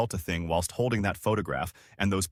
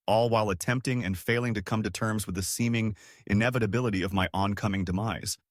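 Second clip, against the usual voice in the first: ordinary delivery, nothing marked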